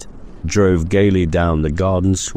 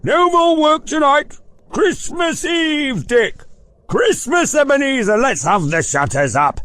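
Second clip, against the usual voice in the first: jovial Fezziwig. Acted. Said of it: "cried old Fezziwig".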